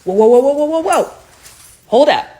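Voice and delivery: calling a halt. Real, not acted. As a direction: cut in loudly to stop them. Cuts in to halt the appeal.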